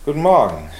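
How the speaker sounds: hearty loud good morning; greeting a regular loudly on arrival. Acted. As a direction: call it out loudly. Loud greeting across the room.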